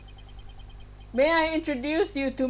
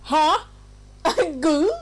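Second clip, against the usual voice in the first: ordinary talk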